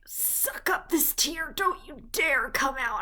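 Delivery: slow, pleading order to her own tears; stern self-command. Retold or imitated. Telling a tear not to fall in public, slow strained plea. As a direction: drag it out as if willing a tear back.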